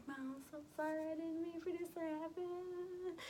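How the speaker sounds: high, whiny mock sobbing apology; squeaky hammed-up crying; imitated bawling. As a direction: wail it like an exaggerated crying child. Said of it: "Just bawling my eyes out".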